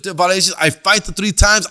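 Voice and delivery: ordinary talk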